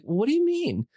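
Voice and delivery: high, loud baffled question; bewildered. Retold or imitated. A sick intern again baffled by his boss, pitched high. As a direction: sound completely clueless.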